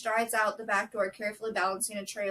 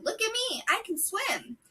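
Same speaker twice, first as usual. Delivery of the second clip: put-on very high little-girl voice; excited child calling out; 13 semitones higher than usual. A narrator's reading. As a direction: call out proudly like a small child. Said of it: "screaming and laughing, calling".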